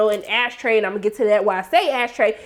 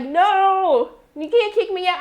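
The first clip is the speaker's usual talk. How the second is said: higher, drawn-out protest; mock upset; 6 semitones higher, slower than usual. Drunk man being kicked out of the bar, mock upset. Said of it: "He gets all upset".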